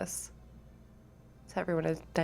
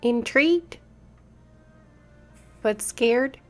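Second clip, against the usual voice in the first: pixie; 6 semitones higher, 5 dB louder than usual. A narrator's reading. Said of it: "you hear a tiny voice say".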